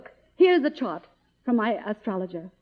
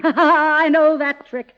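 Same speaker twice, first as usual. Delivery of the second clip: slow, louder line starting with a laugh; knowing laugh; 5 semitones higher, 8 dB louder, much slower than usual. Acted. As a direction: laugh off the trick, savouring it. Scoffs at studio's call.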